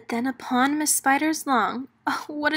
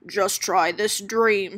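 lower, slow sly dealer voice; scheming; 3 semitones lower, slower than usual. Acted. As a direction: lure them in like a crook. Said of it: "Skid Skipper schemed".

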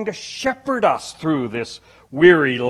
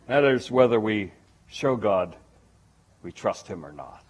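ordinary talk

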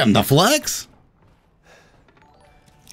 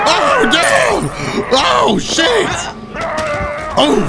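startled yelp; much slower than usual. Real, not acted. Jump scare in game.

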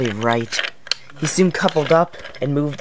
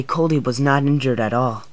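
ordinary delivery

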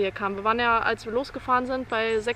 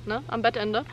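ordinary talk

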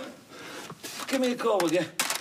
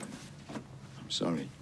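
hushed; 14 dB quieter, much slower than usual. Acted. Hushed apology.

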